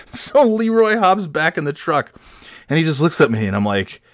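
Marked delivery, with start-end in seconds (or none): none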